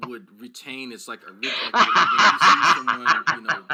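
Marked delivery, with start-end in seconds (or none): 1.7-3.6 loud laughter breaking the words; laughing through words. Real, not acted. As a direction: talk while cracking up. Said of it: "laughing while talking".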